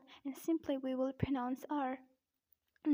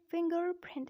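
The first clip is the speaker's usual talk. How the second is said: raised-pitch American "Fingerprint", R after the g; American accent; 4 semitones higher than usual. Shown as a demonstration. Teacher repeating the word for learners, American accent. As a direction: sound both Rs, American style: 'fing-gerr-print'. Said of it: "But in American accent, we say it".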